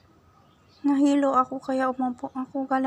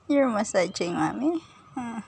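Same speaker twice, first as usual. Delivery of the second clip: ordinary talk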